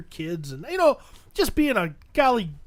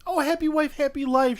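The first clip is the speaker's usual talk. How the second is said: amused reaction; 6 semitones higher than usual. Retold or imitated. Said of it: "she starts laughing, and she goes".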